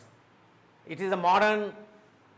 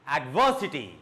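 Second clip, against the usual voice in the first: adversity with VER raised, then down; stress on VER; 3 semitones lower than usual. Shown as a demonstration. Teacher drilling words ending in T Y, stress on VER. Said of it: "a raise of your voice".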